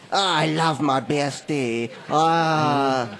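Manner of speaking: whiny, slow put-on voice; snivelling geek impression. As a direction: do a feeble whiny nerd. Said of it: "a shit Matt impression".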